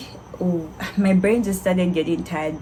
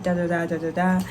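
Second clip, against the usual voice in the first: ordinary delivery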